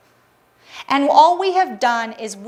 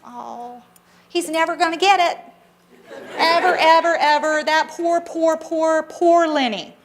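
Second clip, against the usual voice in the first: higher, hammed-up pitying singsong; mock pity; 3 semitones higher than usual. Speaker mocking how people pity a friend, condescending pity. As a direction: pat him on the head, pretending to feel sorry. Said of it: "I don't go".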